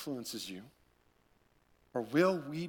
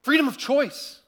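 retold rising cry, much higher and louder; mock impassioned outburst; voice picking up; 10 semitones higher, 9 dB louder than usual. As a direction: echo her voice climbing high on freedom. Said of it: "her voice picks up and she says".

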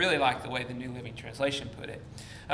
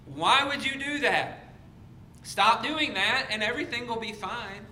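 exasperated pleading; 8 semitones higher than usual. Acted. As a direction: beg them to stop, hammed up in disbelief. Same TV-yelling at biblical Israel.

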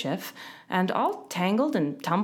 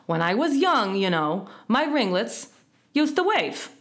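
put-on higher-pitched old wasp voice; querulous old storyteller, pitched up; old wasp reminiscing; 3 semitones higher, 3 dB louder than usual. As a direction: begin a tale of lost youth.

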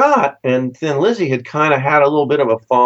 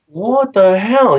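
higher, slowed disbelief; a stunned what the hell; astonished; 8 semitones higher, 3 dB louder, much slower than usual. Retold or imitated. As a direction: stretch out the shock of seeing them walk in. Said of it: "It's like".

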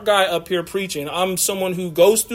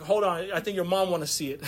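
softer, quick aside; a softer, hurried second thought; hushed hold on; 10 dB quieter than usual. Retold or imitated. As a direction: pull back quietly, remembering mom. Dad stops, remembers mom.

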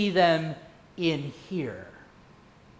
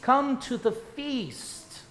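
drawn out, softer closing; slowed final line; 3 semitones higher, 3 dB quieter, much slower than usual. Shown as a demonstration. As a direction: stretch out the last come to the feast. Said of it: "The feast is ready".

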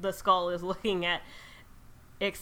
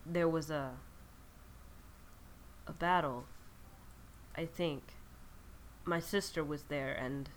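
glitching lich; 5 semitones lower, 11 dB quieter than usual. Acted. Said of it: "glitches for a second and goes".

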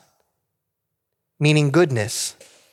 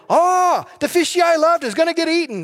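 put-on much higher, fast, panicky little-child voice; scared toddler; 13 semitones higher than usual. A two-year-old watching the shark scene, high and panicky. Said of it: "they're like".

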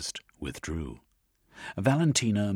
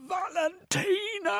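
long, very high anguished wail; anguished cry for his fallen love; slower than usual. A narrator's reading. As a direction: cry her name in despair. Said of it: "he wailed".

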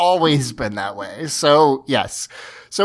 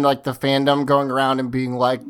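ordinary delivery

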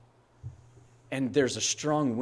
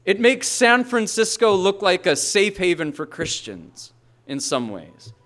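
loud, higher, slowing preacher's cry; the pastor building to a thundering point; full-force preaching; 6 semitones higher, 6 dB louder than usual. Acted. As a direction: preach it out with rising fire.

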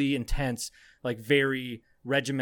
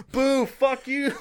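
much higher, slow drawn-out boo; mock crowd booing; 11 semitones higher, 4 dB louder, much slower than usual. Recruits jeering the boastful kid, slow mock boo. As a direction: jeer like a mocking crowd. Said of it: "everyone was like".

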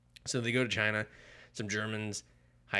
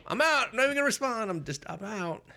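much higher, louder, panicky yelp; panicked henchman; 14 semitones higher, 4 dB louder than usual. Retold or imitated. Spooked dock henchman bailing on the Shadow, high panicked yelp. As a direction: squeak out your exit like a spooked thug.

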